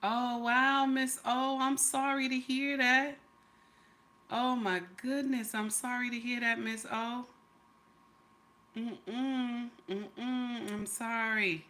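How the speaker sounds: soft, hushed condolence; quietly sorry for a friend; sincere sympathy. Real, not acted. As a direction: drop your voice and sympathize gently. Hears bad news from Miss O.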